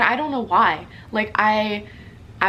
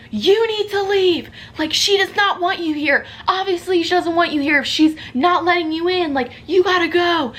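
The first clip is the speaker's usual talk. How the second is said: higher, louder, scolding the intruder; mock scolding neighbors; 7 semitones higher, 4 dB louder than usual. Elderly neighbors telling Ted to leave, mock scolding. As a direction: shoo him away like an outraged neighbor. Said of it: "they're like".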